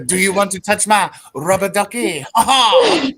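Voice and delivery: high mock French character voice; cartoon Frenchman, laughing "ha ha"; Pierre Escargot impression. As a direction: ham up a silly French sketch guy. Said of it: "he would be like".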